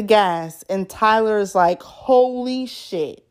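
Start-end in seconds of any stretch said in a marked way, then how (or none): 1.8-3.2 retold amazement. Retold friend's stunned reaction.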